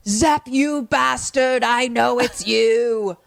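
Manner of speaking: slow, high, loud mock accusation; hammed-up angry yell. Carrie Larry answering the captor's call, mock furious yell. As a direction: stretch each word in overblown fury. Acted accusation over the phone.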